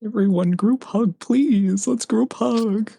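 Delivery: high-pitched, pleading rally to friends; pleading call. Acted. Character calling the party into a group hug, high pleading. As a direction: plead in a high voice, drawing it out at the end.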